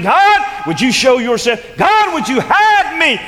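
building to shouted pleas; desperate prayer. Acted. Preacher praying as David, building to shouts.

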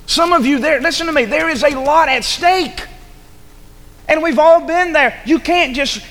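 high, insistent preacher's rebuke; forceful preaching. Real, not acted. Preacher telling listeners there's a lot at stake, forceful. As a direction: press the warning home, pitch lifted high.

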